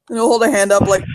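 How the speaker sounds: ordinary talk, nothing marked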